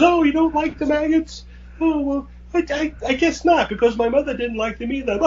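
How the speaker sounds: very high mock-villain voice breaking into cackling; maniacal villainess. Woman taunting the man covered in maggots, maniacal.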